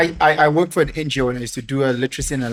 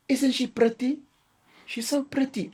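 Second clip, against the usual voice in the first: high, sweet coaxing mother; doting mother voice; 8 semitones higher, 7 dB quieter than usual. Retold or imitated. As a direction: gush like a proud mom. Mother pushing daughter, voiced.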